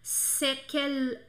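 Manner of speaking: slow, careful "quelle" with a clear L; French teacher modelling "quelle". Shown as a demonstration. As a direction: spell out the sound of "quelle" slowly. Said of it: "então tem que ser quelle".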